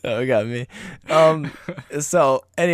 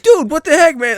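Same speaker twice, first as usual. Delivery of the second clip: mock angry driver; 13 semitones higher, 5 dB louder than usual. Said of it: "he's like".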